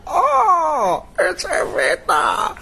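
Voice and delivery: high, drawn-out slow delighted oh; delighted Mandela greeting. Retold or imitated. Mandela spotting Evita on stage, drawn-out delight. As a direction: greet her like an old friend.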